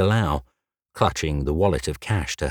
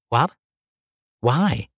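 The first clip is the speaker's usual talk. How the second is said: lightly voiced polite receptionist, higher; a hint of a bright front-desk girl, pitched up; 7 semitones higher than usual. Red-haired receptionist greeting Jay, lightly higher polite voice.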